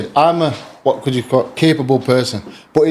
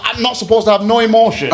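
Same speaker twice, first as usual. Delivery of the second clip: ordinary delivery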